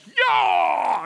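high, drawn-out acted yowl; mock yell of pain. Kid stabbed in the ankle with a pipe, mock pain yell. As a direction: act out a sudden yelp of pain.

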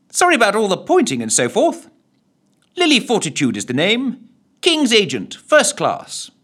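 brisk, well-bred introduction; Lily introducing herself in plummy tones; plummy lady's voice. A narrator's reading. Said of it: "in rather pleasing plummy tones".

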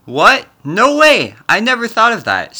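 high, loud astonishment; surprised shout. Acted. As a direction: blurt it out in shock. Stunned by new idea.

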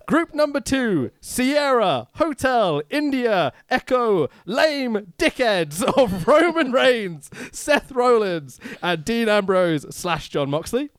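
game show host reading categories; announcer read. Acted. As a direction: announce each name with flair.

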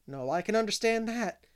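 matter-of-fact maid; plainspoken maid voice. A narrator's reading. Charlotta answering.

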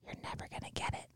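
breathy whispered aside. Real, not acted. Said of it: "that was my inside voice speaking out".

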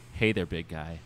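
low, soft greeting; tender murmur. Acted. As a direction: say it softly, close to him. Tender reunion of two men.

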